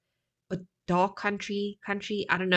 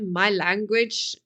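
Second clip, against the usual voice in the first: normal talking voice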